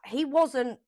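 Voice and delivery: ordinary talk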